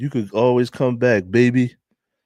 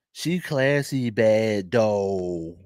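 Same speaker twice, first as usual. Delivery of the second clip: Philly accent; much slower than usual. Real, not acted. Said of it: "You can hear all my Philly accent".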